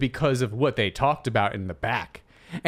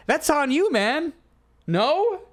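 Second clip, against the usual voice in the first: rising challenge; 10 semitones higher, slower than usual. Real, not acted. Arguing her point.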